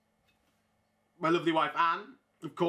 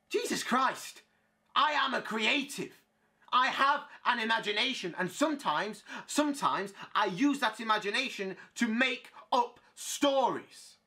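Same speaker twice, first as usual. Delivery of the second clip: high, emphatic acted indignation; exasperated; 5 semitones higher than usual. Playwright defending his invented love stories, high and exasperated. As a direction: spell it out in exasperation.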